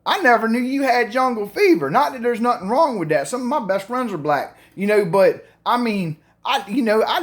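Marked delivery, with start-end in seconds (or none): 0.0-1.9 higher, mock-shocked blurt. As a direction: act stunned at mom's secret. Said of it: "I was shocked".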